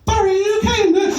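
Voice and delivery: high, put-on parental call. Parent checking on Barry in the caravan, high put-on voice. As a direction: call through the door in a high voice.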